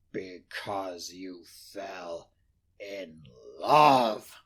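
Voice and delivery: low, slow, scornful growl; mock his words with a growl; growled mockery. Scrooge mocking his nephew's reason, low growl. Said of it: "growled Scrooge".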